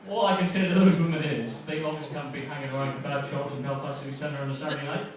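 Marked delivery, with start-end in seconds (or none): none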